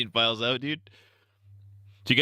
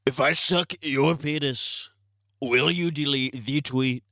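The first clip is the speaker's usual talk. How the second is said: slow, raised presidential parody; Trump impression; 3 semitones higher, slower than usual. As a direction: ask slowly as a mock Trump. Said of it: "You think Trump gave Elon".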